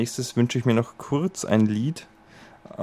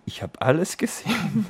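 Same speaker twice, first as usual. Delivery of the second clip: teasing; 9 semitones higher than usual. Pretend. Teasing a dancing stranger.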